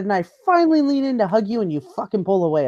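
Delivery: high-pitched exasperated accusation; indignant, re-enacted tirade coming down in pitch; retold losing it. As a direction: act out the fed-up rant, starting high. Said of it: "and I lose my shit".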